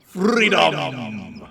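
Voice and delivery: ordinary talk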